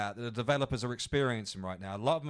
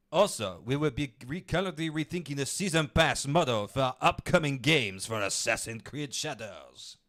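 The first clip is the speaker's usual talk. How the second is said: mock French accent reading the statement; exaggerated French accent read; 2 semitones higher, 5 dB louder than usual. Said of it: "I did it in a French accent".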